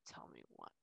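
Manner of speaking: hushed unvoiced whisper; whispering to spirit. Real, not acted. Medium whispering questions to a crying spirit. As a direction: breathe the words with no voice.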